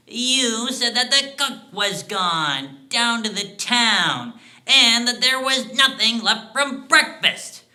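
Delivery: high, loud denunciation building to a peak; triumphant accusation. Acted. Dwarf exposes lies told to the tramp, accusing triumphantly.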